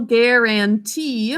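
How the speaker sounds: "guarantee" stretched, last syllable stressed; teacher isolating the last syllable, slow; slow sound-out. Shown as a demonstration. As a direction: stretch "guarantee" to hear the end. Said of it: "And last syllable".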